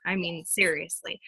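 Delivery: soft laughing whisper after a chuckle; laugh, then whisper it like a confession; whispered aside. Real, not acted. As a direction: drop to a breathy whisper, half joking. Laughing aside about asking favors.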